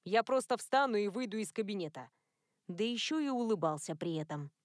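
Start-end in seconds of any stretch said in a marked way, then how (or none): none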